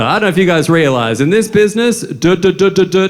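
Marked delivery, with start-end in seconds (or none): 2.0-3.1 imitating a stutter with repeated duh; mock stutter. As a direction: stammer the syllable over and over. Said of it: "he's got a stutter".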